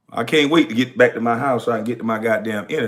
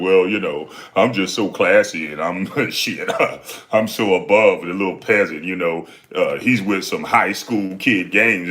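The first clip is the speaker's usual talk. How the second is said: mock smug, lower-pitched impression of a snob; smug superiority; 5 semitones lower than usual. Ranter mimicking critics who act above it all, smug superiority. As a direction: put on a lofty, lowered voice, mocking them.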